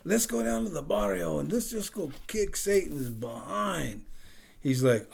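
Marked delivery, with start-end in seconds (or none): 0.0-4.0 imitated pastor's heavy Mexican-American accent, higher pitched. Pastor quoting eager churchgoers, heavy Mexican-American accent. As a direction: put on a Mexican-American accent as a comic impression. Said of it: "with a heavy accent".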